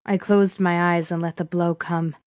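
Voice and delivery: plain reading voice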